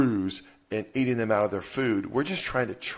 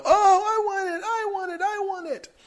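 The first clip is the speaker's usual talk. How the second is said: put-on much higher, loud whining kid voice, crying out; whiny begging kids; 4 dB louder than usual. Imitating his kids begging for his chocolate, whiny high pleading. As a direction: beg like a greedy little kid, hammed-up. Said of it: "And they go".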